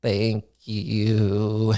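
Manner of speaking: drawn-out, tentative mock thank-you; sheepish thanks. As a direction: say it as if peeking round a door, unsure. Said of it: "creakily open the door and just".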